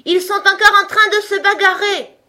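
high, very loud sustained holler; full-force yell. A narrator's reading. Messenger yells news at the king instead of whispering, full-force yell. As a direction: yell it with all your might. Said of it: "hurla de toutes ses forces".

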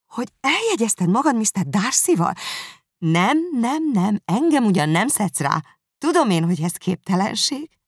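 high, loud protesting sister; Jane refusing. Acted.

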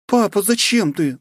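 put-on high, urgent young princess; young woman voice. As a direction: voice her as an alarmed young woman. Svetlana's voice. (встревоженная княжна)

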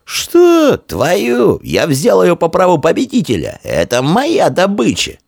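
very high, indignant retort; defiant shouting. Acted. Foe claiming tunic as victor's prize, defiant shouting. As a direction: throw the accusation back, high and heated. Foe disputes theft accusation.

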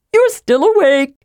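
put-on eager, chirpy young kender; cheerful kender voice. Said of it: "the cheery voice went on".

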